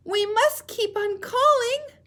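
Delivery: very high, loud, slow penguin voice; a chick urging them on; Little Penguin voice. Shown as a demonstration. Teacher voicing Little Penguin, determined. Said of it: "show you how to use voices".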